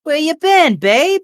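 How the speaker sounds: high-pitched diner waitress fussing over a regular; friendly waitress. Acted. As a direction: greet a regular in a high, gushing voice. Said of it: "kinda walks by and goes".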